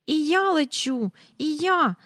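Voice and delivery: put-on high squeal of a girl flying. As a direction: Jane squeals, delighted to fly. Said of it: "заверещала Джейн". (високий захоплений вереск дівчинки)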